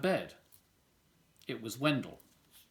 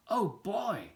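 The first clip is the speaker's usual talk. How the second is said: put-on excited boy voice; 6 semitones higher than usual. Wendell's eager reply.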